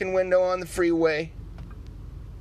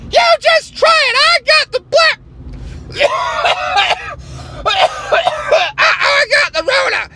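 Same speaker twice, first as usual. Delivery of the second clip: high, loud indignant activist voice; outraged liberal caricature; 8 dB louder than usual. Acted. Liberal character shouting.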